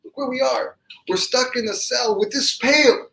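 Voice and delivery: anguished protest. Acted. Crying brother answers the rebuke from the cell, anguished protest. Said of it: "he started crying, bawling".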